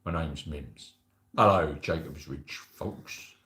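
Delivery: very low, slow, soft character voice; imagined Mims voice. Pretend. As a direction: drop way down for a drawn-out, put-on greeting. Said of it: "You might be like".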